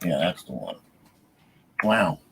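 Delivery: ordinary talk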